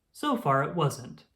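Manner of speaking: ordinary delivery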